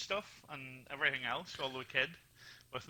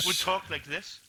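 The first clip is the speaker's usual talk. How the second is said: phone voice; 3 dB louder than usual. Shown as a demonstration. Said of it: "when I use my phone voice".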